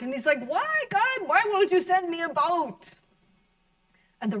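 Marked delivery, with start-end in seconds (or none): 0.5-2.8 high, strained mock wail to God; retold crying out. Man at the riverbank crying out to God, high anguished wail. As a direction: cry out to God like a desperate man, pitched way up. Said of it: "he's really crying, crying out".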